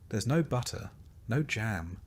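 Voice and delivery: usual speaking voice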